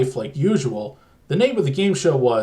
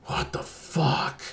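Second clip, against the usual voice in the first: barely audible, slow whisper; 7 dB quieter, slower than usual. Acted. Telly in a dark basement when the TV dies, slow near-silent whisper. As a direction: breathe it out almost inaudibly, slowly. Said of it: "he whispered almost inaudibly".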